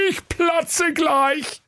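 very high whimpering octopus voice. Acted. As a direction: whimper it out, stretched slow. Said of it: "wimmerte der Tintenfisch".

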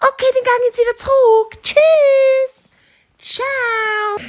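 very high voice, long-drawn goodbyes; high animal goodbye. Acted. Hare leaves the cheetah, high calling goodbyes. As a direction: rattle it off, then stretch the farewell.

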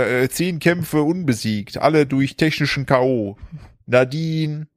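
slow, drawn-out fighter name call; boxing ring announcer. Acted. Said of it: "Warte, ich muss das sagen. Pass auf".

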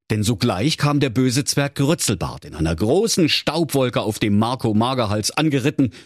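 ordinary delivery, nothing marked

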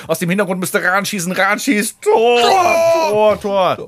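building to a screamed goal call; screaming goal commentary. Retold or imitated. 1954 World Cup goal call.

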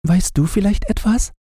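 put-on high, quick girlish question; girl asking. Gretchen asks the lily, high quick child voice. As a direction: ask the flower eagerly, high and fast.

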